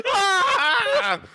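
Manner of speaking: high laughing shriek; hammed-up scream through laughter; mock scream. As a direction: shriek in pretend attack. Acting out attack while laughing.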